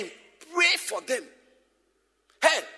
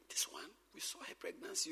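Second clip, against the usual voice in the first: softer, lower gossiping whisper; mock whispered aside; 4 semitones lower, 13 dB quieter than usual. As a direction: hush it like a secret at the wedding. Quoting a remark at a wedding.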